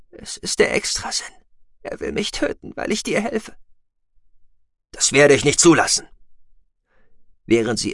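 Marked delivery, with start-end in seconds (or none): 0.1-3.6 weak whisper. Acted. Dying woman speaks weakly. 4.9-6.1 determined vow. Acted. Vows to protect her.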